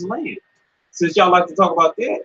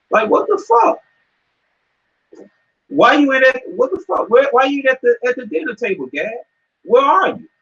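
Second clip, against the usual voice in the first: exasperated shout; 8 semitones higher than usual. Real, not acted. Calling out brother Gad.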